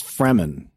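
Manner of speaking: "Fremen" said FREM-en; correct pronunciation of Fremen. Shown as a demonstration. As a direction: correct him with "Fremen", r before a short e. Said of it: "you like to mispronounce things".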